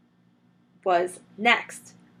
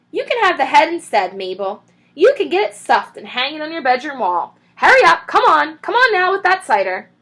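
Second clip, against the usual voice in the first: impatient call; 7 semitones higher, 8 dB louder than usual. A narrator's reading. Mrs Bean hurrying Mabel.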